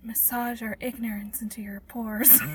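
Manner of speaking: hushed breathy spa whisper; soft spa whisper. Pretend. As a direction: whisper like a massage therapist. Mock spa intro whispering.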